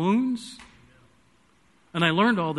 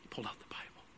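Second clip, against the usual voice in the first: breathy hushed whisper; 10 semitones lower, 25 dB quieter, much faster than usual. Real, not acted. Preacher whispering the turning point to the congregation. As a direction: drop to a whisper for the moment. Hushed key moment in story.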